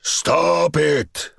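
hard furious order; screamed. A narrator's reading. General screaming at the marksman, furious. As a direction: scream the command. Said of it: "Borovitz screamed".

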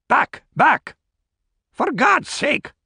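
high, shrill exclamation; shrill cry. A narrator's reading. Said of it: "shrilled Grant".

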